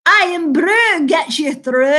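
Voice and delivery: put-on slow Scottish accent, like the advert slogan. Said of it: "If you want to learn a Scottish accent".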